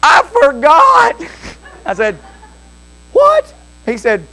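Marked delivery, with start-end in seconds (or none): 0.0-1.2 high, drawn-out mock wail; screaming child. Small boy realizing he broke the rules, screamed. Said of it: "he started screaming". 3.1-3.5 startled question. Retold or imitated. Father reacting to screaming boy.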